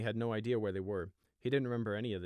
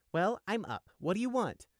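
high, falsely innocent, smiling; 11 semitones higher, 5 dB louder than usual. Acted. Prisoner sheep needling the guard: innocent. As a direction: play innocent with a smile. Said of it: "with an innocent smile".

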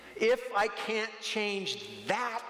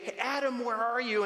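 mock angry father; 6 semitones higher than usual. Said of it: "He didn't do that".

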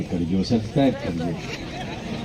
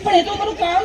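nurse's voice. Retold or imitated. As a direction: drag it out sweetly, much higher. (ધીમા ઊંચા મીઠા અવાજે nurse)

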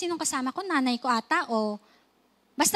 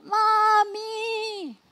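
put-on high, wailing child's cry, turning desperate; a hurt boy sobbing for his mother; crying for mommy; 8 semitones higher than usual. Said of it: "iyak po ng iyak". (desperadong iyak ng bata para sa nanay)

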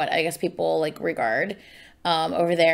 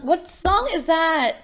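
high, slow, drawn-out question; lifted voice, searching memory for a tune; puzzled question; 8 semitones higher, slower than usual. Real, not acted. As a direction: wonder aloud, stretched and high. Puzzling over a half-remembered tune.

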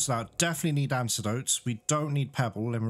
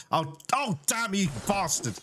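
mildly outraged, pitch jumping up; exasperated cursing; 6 semitones higher than usual. Real, not acted. Player cursing an enemy mid-game, exasperated. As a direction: curse the enemy in higher-pitched frustration.

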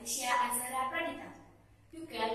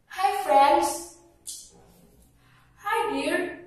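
cheerful greeting; 6 semitones higher, 10 dB louder, slower than usual. Acted. Mouse deer and frogs greet.

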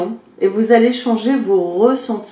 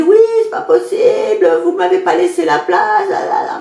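mimicking angry yelling; 3 dB louder than usual. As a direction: parody the irate driver shouting. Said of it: "il commence à me hurler dessus".

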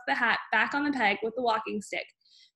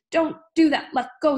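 loud, high, urgent protest; pained yell; 5 semitones higher, 6 dB louder than usual. A narrator's reading. Father yelling at his wife to stop yanking. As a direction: shout at her to stop pulling. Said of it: "let out a yell that rattled the window panes".

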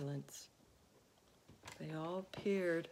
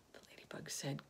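ordinary delivery

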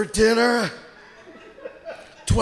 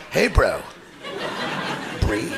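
a calm gadget voice, much lower; the watch calmly telling him to breathe, low; Fitbit voice; 13 semitones lower, 3 dB quieter, slower than usual. Retold or imitated. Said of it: "it's like".

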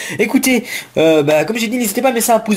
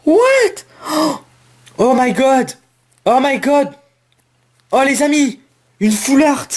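thrilled exclaiming; 8 semitones higher, slower than usual. Real, not acted. Pulls a rare full art card.

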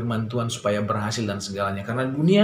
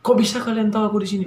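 fast, high, astonished question; surprised; 10 semitones higher, 4 dB louder, faster than usual. Retold or imitated. Rescued castaway asks his rescuers, high and astonished. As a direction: blurt it out in disbelief. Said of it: "Wah, dia kaget".

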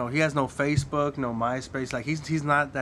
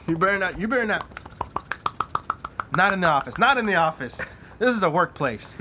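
mock scolding; 4 semitones higher, 4 dB louder than usual. Scolding friend about office.

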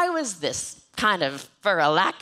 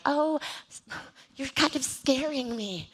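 soft, slow, timid voice; mock timid comment; 5 semitones higher, 5 dB quieter, slower than usual. A follower's timid comment on her posts, soft and slow. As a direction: say it shyly, backing away. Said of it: "comments that are like".

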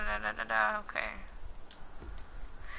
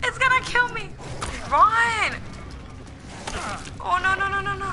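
panicked; 11 semitones higher, 3 dB louder than usual. Real, not acted. Being chased again in game.

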